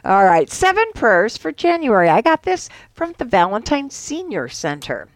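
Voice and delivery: ordinary delivery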